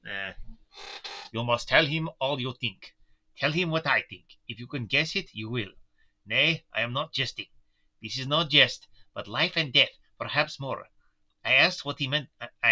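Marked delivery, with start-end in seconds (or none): 1.3-10.9 put-on Van Helsing with a German accent; thick German accent, grave and insistent. German professor warns the doctor, German accent. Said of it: "Oh, Otto Scratch and Sniff".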